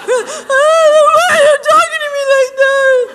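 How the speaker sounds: loud, high, laughing hurt cry; hurt high shout. Retold or imitated. The woman asking why he speaks that way, a high laughing cry. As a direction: wail it like she's wounded. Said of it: "you're like".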